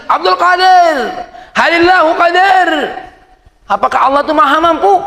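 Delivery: bertanya tinggi dan lantang; suara si pemabuk. Retold or imitated. (pemabuk bertanya keras)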